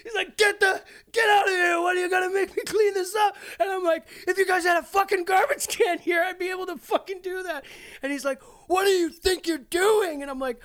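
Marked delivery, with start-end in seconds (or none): 0.4-3.4 high, loud mock yell; referee shouting. Referee yelling at the puking player, loud and high. 4.3-7.6 high, loud mock argue; arguing back. Player yelling back at the referee, high and loud. 8.7-10.2 slow, high, loud mock demand; referee yelling. Referee demanding an answer, slow high yell.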